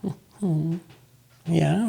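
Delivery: small, high, soft agreement; imitated toddler. Toddler agreeing to father's silly question, small high voice. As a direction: agree like a little child. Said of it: "was, "Mm-hmm, mm-hmm".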